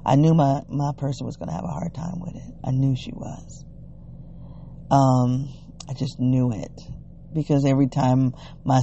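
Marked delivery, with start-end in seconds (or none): none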